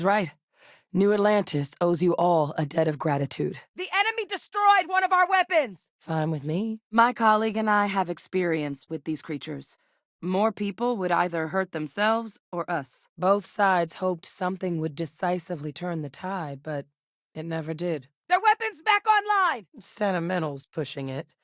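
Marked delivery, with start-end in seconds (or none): 3.7-5.9 high-pitched shouted report; urgent battle cry. Acted. Warning crew a weapon is destroyed, urgent shout. 18.3-19.7 high-pitched shouted alert; urgent battle warning. Acted. Warning crew of enemy weapon, urgent shout.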